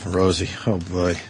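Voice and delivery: usual speaking voice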